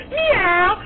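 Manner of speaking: high drawn-out cat call; yelled meow. Retold or imitated. Two teammates echoing the cheer, yelled meow. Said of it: "two other people were like".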